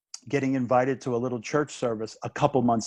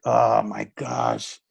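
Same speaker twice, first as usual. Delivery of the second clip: slow, drawn-out mock dismay; 2 semitones lower, much slower than usual. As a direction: stretch each word as if it's sinking in. Retells his dismayed reaction.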